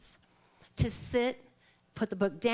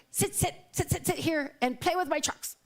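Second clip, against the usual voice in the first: put-on higher, insistent child voice; mock toddler; 6 semitones higher than usual. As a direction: play the eager little boy. Toddler voice in story.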